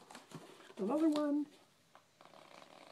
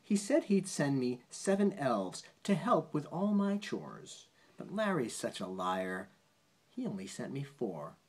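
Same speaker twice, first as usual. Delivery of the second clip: bouncy rhyming read-aloud; reading a poem to a child; 4 semitones higher, 4 dB louder than usual. Acted. Parent reading a bedtime poem to a child, sing-song read-aloud. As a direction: lilt the rhyme for a child at bedtime.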